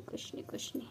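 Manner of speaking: soft whispered soothing; hushed soothing. Real, not acted. Owner whispering softly to calm the frightened kitten. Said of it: "soothing the kitten, hushed".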